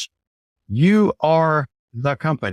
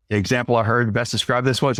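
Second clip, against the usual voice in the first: ordinary talk, nothing marked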